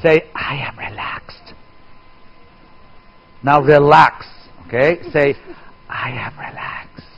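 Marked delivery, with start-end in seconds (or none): 0.5-1.5 much softer, hushed whisper. Shown as a demonstration. 6.1-7.1 slow, soft unvoiced whisper. Shown as a demonstration.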